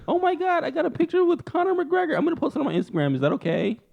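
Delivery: very high mock fangirl. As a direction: gush in a put-on, high girlish voice.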